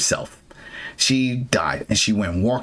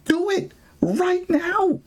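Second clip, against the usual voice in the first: ordinary talk, nothing marked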